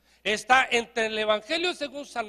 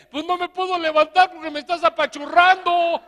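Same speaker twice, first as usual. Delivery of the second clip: dead man's complaint; 4 semitones higher, 6 dB louder than usual. Acted.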